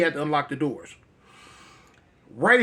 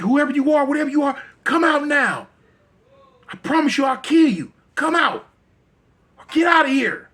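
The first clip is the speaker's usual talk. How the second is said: much higher, louder threats into the dark; threatening shout; 9 semitones higher, 5 dB louder than usual. Acted. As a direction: bark it at something you fear. Steve threatens unseen light.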